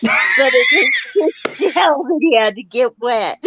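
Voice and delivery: loud, laughing through the punchline; half shouting with laughter. Real, not acted. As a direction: crack up as you finish the line.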